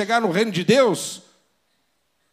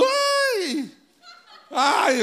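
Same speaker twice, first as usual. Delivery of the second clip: very high mock girlish shriek of fright; emperor screaming like a girl; 10 semitones higher than usual. Preacher mocking the terrified emperor, very high girlish scream. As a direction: shriek it like a scared little girl. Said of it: "Imagina o imperador gritando igual uma menininha".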